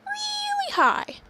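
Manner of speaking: very high, drawn-out squeak; really high. Shown as a demonstration. Announcing Gil's voice for the play, really high. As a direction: stretch it out way up high. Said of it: "And Gil is gonna talk".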